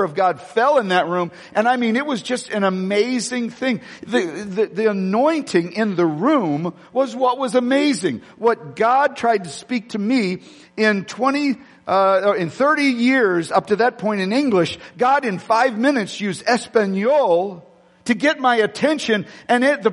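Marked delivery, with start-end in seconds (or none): none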